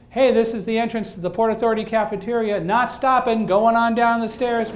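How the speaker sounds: loud, high call down the stairwell; calling out to people below. Retold or imitated. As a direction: shout the floor number out to everyone. David shouting floor numbers.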